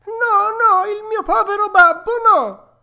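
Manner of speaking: high, loud desperate cry; cornered desperation. Acted. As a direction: plead in panic for his father. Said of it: "gridò Pinocchio con accento disperato".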